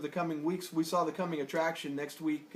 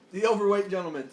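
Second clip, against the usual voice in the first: ordinary talk, nothing marked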